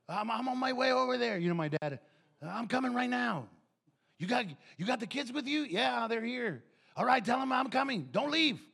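loud, high, eager grandpa on the phone; excited grandpa. Retold or imitated. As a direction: hurry over, thrilled to see the kids.

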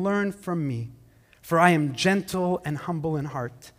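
unhurried tender scripture reading. Acted. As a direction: read the promise gently and slowly. Reading Jesus' words slowly.

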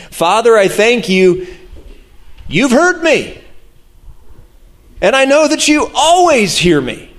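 high, impassioned Scripture reading; fervent prayer. A narrator's reading. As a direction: lift the voice high in thanks to the Father.

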